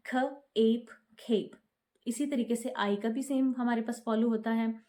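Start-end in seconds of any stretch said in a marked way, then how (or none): 0.1-1.5 ख, एप, खेप split into sounds, then joined; teacher sounding out खेप; sounding out with magic e. Shown as a demonstration. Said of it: "ऐसे उसका sound हो जाएगा ए".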